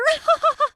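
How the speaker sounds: high cackling mock laugh. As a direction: laugh at her pain. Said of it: "she was like".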